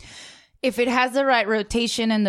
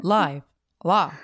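deep computer voice; 5 semitones lower than usual. Retold or imitated. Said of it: "She has a, a deep life".

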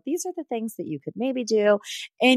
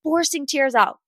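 higher, gloating mock confessional voice; mocking Shanae; 6 semitones higher than usual. Vlogger mimics Shanae's confessional, in a high gloating mock voice. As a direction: parody her bragging about fake tears. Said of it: "go in a confessional and say".

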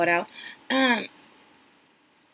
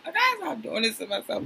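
usual speaking voice

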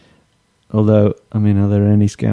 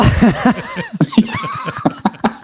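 high laugh. Real, not acted. Laughing after the chant.

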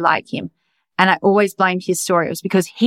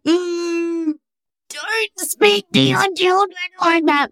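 high, prim disapproving tut; mock the prissy voice in your head that scolds other parents; judgy inner voice; 11 semitones higher, much slower than usual. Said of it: "a part of me that was like".

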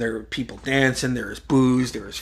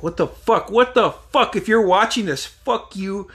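high-pitched acted outrage; exasperated mock tirade; retold angry rant; 8 semitones higher than usual. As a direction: curse out whoever is watching, voice raised. Retold rant at elevator cameras.

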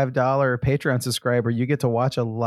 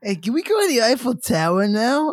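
high, put-on French tourist; hammed-up French tourist; 10 semitones higher than usual. Shown as a demonstration. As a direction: ask like an eager French tourist. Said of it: "doing the French voice".

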